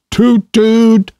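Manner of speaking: high blaring horn imitation; car horn toot. A narrator's reading. The motor car honks at the travelers, a high blaring toot. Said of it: "The horn of the motor car rang out".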